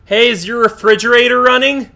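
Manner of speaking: loud, hammed-up prank call voice; prank caller. Prank calling her older brother, loud and hammy. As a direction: belt it down the phone like a cheesy prankster.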